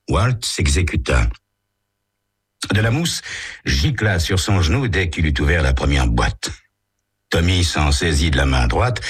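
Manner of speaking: usual speaking voice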